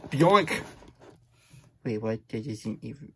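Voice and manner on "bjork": loud nonsense shout of Bjork; silly word. Acted. Reader forced to say the book's nonsense word, blurting it loudly. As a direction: blurt "Bjork!" like a goofy sound. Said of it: "So, the words say".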